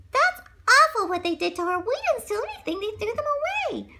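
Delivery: high squeaky mouse voice, upset; indignant mouse. Acted. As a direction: protest like a tiny mouse. Animal friends protesting.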